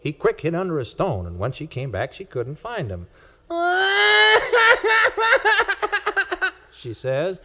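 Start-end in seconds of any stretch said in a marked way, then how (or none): 3.5-6.5 very high sobbing wail; child's whimper. A narrator's reading. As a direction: wail like a child who lost her toy. Little girl cries for worm.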